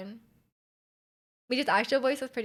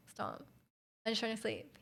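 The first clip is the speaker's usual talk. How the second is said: mock sleepy plea; 13 dB quieter than usual. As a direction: imitate a drowsy, half-hearted protest. Reacting to loud memes while sleepy.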